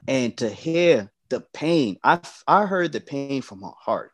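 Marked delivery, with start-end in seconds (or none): none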